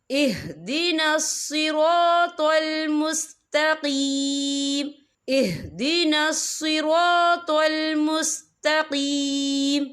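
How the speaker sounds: "सिरातल" with a heavy, full tau and "मुस्तकीम" with a light ta, slowly recited; slow recitation with a thick tau in "सिरातल" and a thin ta in "मुस्तकीम". Shown as a demonstration. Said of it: "यहाँ पे तौ जो है मोटा पढ़ा जाएगा". ("सिरातल" में मोटा तौ, "मुस्तकीम" में बारीक ता)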